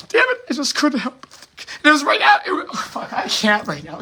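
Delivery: high, strained and near tears; fighting to get the words out, voice giving way; choked up. Real, not acted. Speaker recalling kids playing before a police shooting, choked up.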